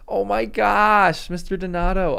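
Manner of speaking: drawn-out stunned exclamation of joy; overwhelmed surprise. Real, not acted. Thanking a viewer for a big donation, stunned and delighted.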